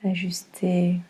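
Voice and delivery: ordinary talk, nothing marked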